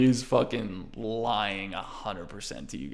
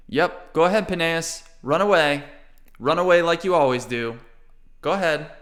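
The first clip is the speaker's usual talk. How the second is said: taunting; 6 semitones higher, 7 dB louder than usual. Acted. Artem taunts Peneus.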